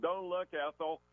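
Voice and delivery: high mock yell from the old song; [name] song quote. Doctor yelling back at the customer, mock high [name] song quote. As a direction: quote the [name] song, a hint of a yelled warning. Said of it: "So I yelled out from the old ... [name] song".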